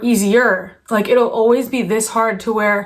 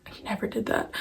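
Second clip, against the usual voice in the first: hushed, voiceless whisper; much softer parenthetical whisper; whispered aside; 13 dB quieter than usual. Real, not acted. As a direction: slip it in under your breath. Whispered aside within her list.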